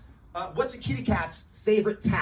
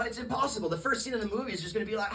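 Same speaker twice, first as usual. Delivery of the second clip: ordinary talk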